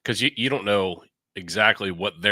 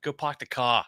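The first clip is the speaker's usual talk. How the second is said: higher-pitched mock Boston accent; 6 semitones higher than usual. Co-host mimicking the annoying accent, mock Boston. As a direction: drop the r's like a Bostonian. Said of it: "than a Boston accent".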